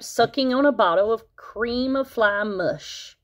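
ordinary delivery, nothing marked

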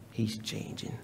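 hushed whispered repeat of He's changing; whispered echo. Acted. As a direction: drop it to a whisper the second time. Repeated for emphasis.

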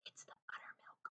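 hushed unvoiced whisper; whispered aside to a neighbour. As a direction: lean in and whisper the secret. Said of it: "whispered Missus Arnold".

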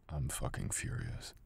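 slow, whispered anger; quiet fury. Acted. Furious partner whispering a threat to stay put. Angry partner holds her there.

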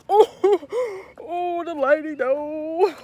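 high, loud giggling through the words; laughing. Real, not acted. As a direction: laugh at how bad it looks. Laughing at the bad lighting.

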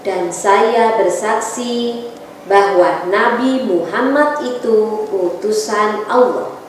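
ordinary talk

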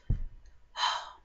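long, breath-only sigh; voiceless exhale of exasperation; exasperated sigh. Real, not acted. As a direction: let out a heavy sigh. Exasperated pause after it sucks.

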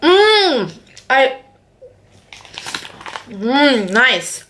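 murmuring while chewing; chewing with mouth full. Real, not acted. Seen 2: Just bit cake, lips closed chewing while murmuring "mm.

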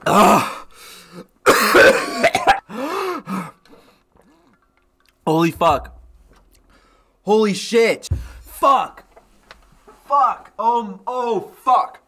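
real pain from the hot sauce; burning mouth. Real, not acted. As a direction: curse through a mouth on fire.

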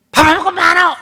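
high, loud nagging scold; exasperated mother scolding in dialect; irritated scolding. Shown as a demonstration. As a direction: snap at the child for not eating. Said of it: "이러면 안 된다는 거예요".

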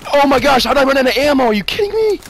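high, loud disbelief; exasperated outburst. Real, not acted. Gamer just ran out of ammo, high exasperated yelling. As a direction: cry out in indignant frustration.

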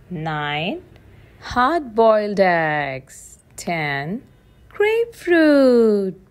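usual speaking voice

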